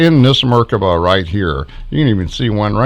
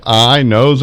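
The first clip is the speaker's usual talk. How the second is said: normal talking voice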